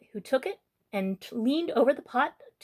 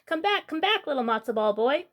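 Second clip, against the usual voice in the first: put-on high calling old bubby, come back; 7 semitones higher than usual. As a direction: call after him across the river, pitched high.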